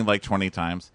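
normal talking voice

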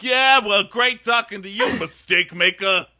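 loud, high, snide goodbye; hammed-up sarcastic send-off; sarcastic mock farewell. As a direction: sneer the goodbye at him. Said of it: "It's like".

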